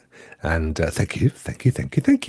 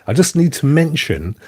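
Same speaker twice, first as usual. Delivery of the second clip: usual speaking voice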